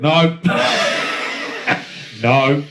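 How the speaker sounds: loud cry of no, breaking into laughter; laughing no. Retold or imitated. Mate acting out Enzo's horrified refusal, laughing loudly. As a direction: shout it, then collapse laughing. Punchline of the lube story.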